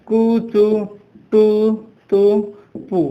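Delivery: syllable by syllable, with pauses between; drawn-out recitation. Shown as a demonstration. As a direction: hold कु, चु, टू, तु, पु on long even vowels. Demonstrates alternative memory trick syllables.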